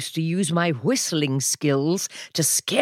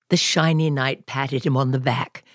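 usual speaking voice

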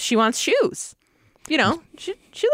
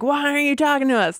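higher, quicker imitation of yelling; half-hearted yell, pitched up and rushed; mock yell; 7 semitones higher, faster than usual. Gerhardts interrogating a guy they are torturing, mock yelling. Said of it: "they're yelling at him".

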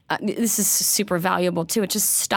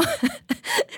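high laugh; 5 dB quieter than usual. Real, not acted. Laughing mid-story.

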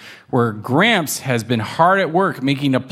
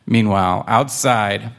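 plain reading voice

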